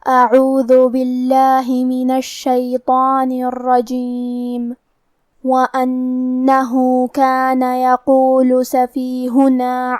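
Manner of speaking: slow, measured tajweed chant; Quran recitation. Shown as a demonstration. Teacher reciting the verse for a tajweed class, slow recitation. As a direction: recite with long held vowels and nasal hums.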